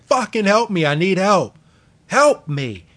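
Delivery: hammed-up cry for help, begging the room; exhausted plea. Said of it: "He's like".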